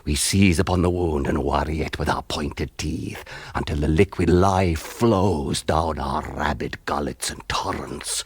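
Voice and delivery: ordinary talk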